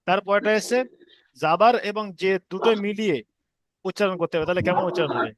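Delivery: ordinary talk, nothing marked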